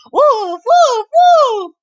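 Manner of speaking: high loud yelping woofs; barking woof. Acted. Mister Dog answering the song with loud barks. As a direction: bark it out like a dog. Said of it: "barked Mister Dog".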